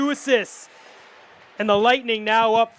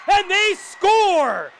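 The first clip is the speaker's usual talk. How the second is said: goal call; 7 semitones higher, much slower than usual. Real, not acted.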